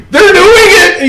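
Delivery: very high mock outcry; high-pitched tattling. Brother turning the family in, high-pitched outcry. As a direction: squeal it like a tattletale.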